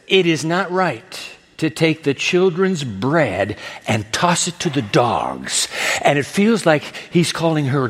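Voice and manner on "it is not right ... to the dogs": slow, deliberate Jesus quote; weighty, unhurried delivery; measured pronouncement. Acted. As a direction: spell it out slowly, falling at the end. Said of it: "he says".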